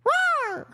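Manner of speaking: squeaky high mock roar; a tiny roar, squeaked way up high and loud; high-pitched roar. Shown as a demonstration. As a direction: roar like a tiny cute beast. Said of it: "it's a high-pitched roar".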